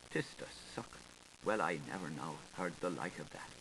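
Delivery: whispered, then a soft, fast murmur; hushed whisper. Acted. Washerwoman hushing her shock to her friend, whispered. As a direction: breathe it like a shocked secret.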